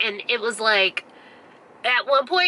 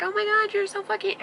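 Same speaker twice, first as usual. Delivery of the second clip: high-pitched retold gushing; gushing gratitude; 12 semitones higher, 4 dB quieter than usual. Campers to neighbor offering a lantern, gushing. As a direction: pitch it way up, imitating delighted thanks.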